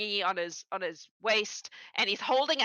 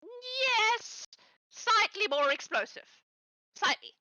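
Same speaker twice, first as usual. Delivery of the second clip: higher, evasive goblin answers; lightly voiced goblin; 10 semitones higher, slower than usual. Goblin downplaying the rocket danger to Alzarin, evasive. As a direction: dodge the question cheerfully.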